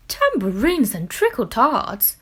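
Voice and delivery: joyful exclamation. A narrator's reading. Grandma seeing hundreds of potatoes, loud joyful exclaim. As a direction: burst out with delight. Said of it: "exclaimed Grandma".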